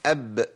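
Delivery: bouncing echo on the final ب of أب; qalqala demo. Shown as a demonstration. The sheikh shows students the qalqala on أب. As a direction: let the ب in أب release with a light bounce. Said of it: "طب نقولهم وحضراتكم يا ريت تقولوا ورايا".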